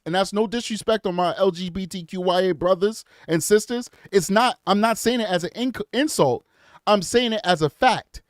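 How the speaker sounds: ordinary talk, nothing marked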